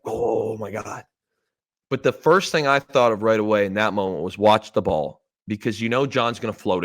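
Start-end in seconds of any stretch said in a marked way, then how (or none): 0.0-1.0 mock gasp.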